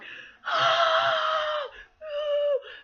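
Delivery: loud, high mock hyperventilating gasp; imitated panic gasp. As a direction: imitate a woman suddenly freaking out. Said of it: "she was all like".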